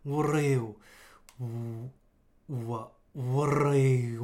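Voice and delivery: slow low Cell voice with a rolled r. Shown as a demonstration. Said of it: "こう低い感じにすると". (低いセルの声)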